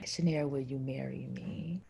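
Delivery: soft whispered question on his knee; hushed, unvoiced marriage proposal. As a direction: breathe it like a nervous proposal.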